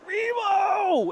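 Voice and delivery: high, drawn-out holler of a name; imitating an excited fan's yell; yelled call. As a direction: call out to someone across a stadium. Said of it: "and he yells out".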